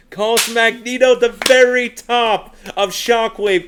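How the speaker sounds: screaming the final moments, very high and loud; excited hype call. Real, not acted. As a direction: peak of the call, full throttle. Match-winning call.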